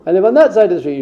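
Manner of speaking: loud, high indignant yell; screaming old lady. Retold or imitated. Old lady yells at the helpful stranger, indignant shout. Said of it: "and she screams".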